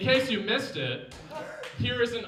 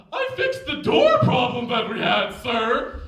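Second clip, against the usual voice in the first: loud, higher put-on sketch voice; eager crewman; 5 semitones higher, 9 dB louder than usual. Crewman Jim proudly reporting to his captain, eager and loud. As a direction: report proudly at full volume.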